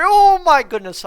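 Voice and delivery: loud, high burst of enthusiasm; excited exclamation. Real, not acted. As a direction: blurt it out, pitch soaring. Exclaims over player's strong period.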